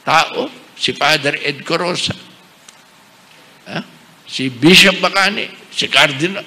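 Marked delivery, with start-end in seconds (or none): none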